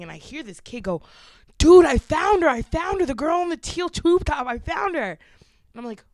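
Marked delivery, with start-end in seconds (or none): 1.6-5.1 high, loud, imitating a thrilled boy; excited retold shout. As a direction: call out to your friends, acting out the discovery. Retold boy calling to his friends.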